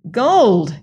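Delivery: loud wondering cry; excited cry. A narrator's reading. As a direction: cry it out, thrilled. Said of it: "he cried".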